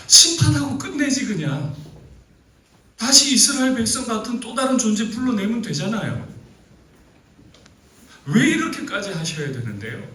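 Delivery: loud and high, fed-up complaint; exasperated rant. Acted. Preacher venting his irritation at God, loud and high. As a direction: vent as if you can't stand it.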